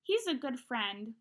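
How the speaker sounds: plain reading voice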